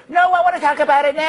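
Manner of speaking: high, fast, pushy reporter voice. Retold or imitated. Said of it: "That was my reporter voice".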